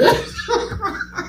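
high breathy laugh; high breathy burst of laughter. Real, not acted. Telling a funny story.

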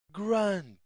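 plain reading voice

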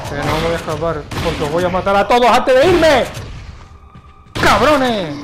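furious yell at the enemies; shouting. Real, not acted. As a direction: bellow the threat, loud and fierce. Threatening enemies in the game. (gritando furioso)

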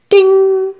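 high ringing ding like a shop bell. Acted. Narrator imitating the shop door bell, high ringing ding. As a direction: sing out the doorbell chime.

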